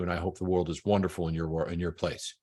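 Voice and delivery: low and soft heartfelt wish; sincere close. Shown as a demonstration. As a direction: close the call softly and sincerely. Sincere close of demo call.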